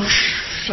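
soft hushing rush of noise; imitated uproar noise. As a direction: imitate a distant commotion with a hiss. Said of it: "oí un alboroto". (siseo imitando un alboroto)

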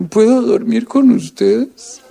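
voz lenta de niño triste, levemente imitada; hijo triste pidiendo. Retold or imitated. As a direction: pide con lentitud de niño desconsolado. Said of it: "esa noche que dijo". (hijo triste pidiendo a sus papás dormir con ellos, voz lenta)

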